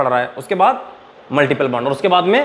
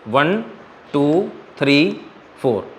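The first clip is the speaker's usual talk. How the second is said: drawn-out slow count; slow, deliberate teacher's count; 2 semitones lower, much slower than usual. Shown as a demonstration. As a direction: stretch each number out for the class. Teacher counts carbons slowly.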